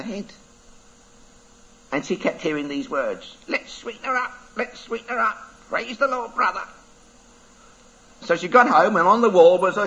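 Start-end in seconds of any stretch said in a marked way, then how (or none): 3.5-6.6 parrot voice. Acted. Words echo in her head.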